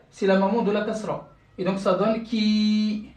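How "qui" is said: "qui" with a drawn-out ee; long vowel. Shown as a demonstration. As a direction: hold the i in "qui" for two beats. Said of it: "prolongation de deux temps".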